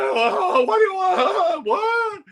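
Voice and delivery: high, drawn-out panicked cry; mock panicked wail. Singer panicking at the press ambush, high wailing. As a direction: wail it in hammed-up horror.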